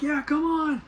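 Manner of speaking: very high-pitched imitation of a cheering crowd; mock cheering. Gamblers holding money urge racers on, mock cheering. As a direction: egg them on in a put-on high voice.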